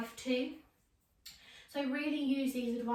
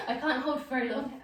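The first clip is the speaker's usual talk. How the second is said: laughing; 2 semitones higher, 4 dB louder than usual. Real, not acted. Laughing while holding pose.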